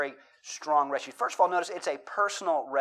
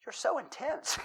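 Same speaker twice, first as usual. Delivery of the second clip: said high through laughter; a teasing line, high and laughing; 9 semitones higher, 6 dB quieter than usual. Retold or imitated. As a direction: giggle out the words. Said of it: "And they said".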